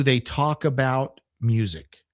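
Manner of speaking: ordinary talk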